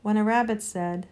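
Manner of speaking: plain reading voice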